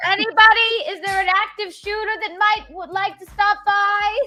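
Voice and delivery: high, loud shout to anyone out there; calling out loud. Pretend. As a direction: call out to the whole street. Calling out to the world.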